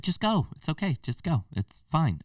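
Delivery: mock shooing off. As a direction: wave them away with a put-on voice. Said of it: "It's like".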